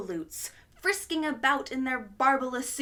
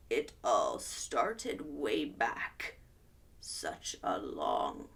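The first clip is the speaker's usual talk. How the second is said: slow, sinking much lower to a whisper; Onceler fading; 13 semitones lower, slower than usual. Acted. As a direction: let the voice sink and fade out. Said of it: "with his teeth sounding gray".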